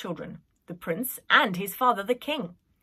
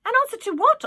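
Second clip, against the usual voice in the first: high nanny question; 8 dB louder, faster than usual. Acted. Nanny asks.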